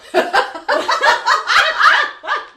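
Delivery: loud high burst of laughter. Real, not acted. Laugh after joke.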